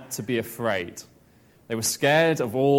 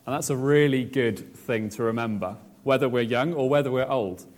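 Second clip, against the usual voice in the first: ordinary talk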